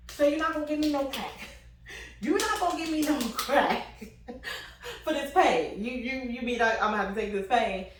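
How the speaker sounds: fake-outraged and giggling; mock outraged.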